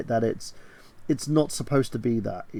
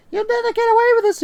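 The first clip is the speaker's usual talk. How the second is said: very high, louder, quick squeak; alien's squeaky voice; 7 dB louder, faster than usual. Retold or imitated. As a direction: squeal it out as the imposing alien's absurd little voice. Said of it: "starts talking like this".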